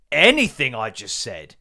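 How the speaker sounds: ordinary talk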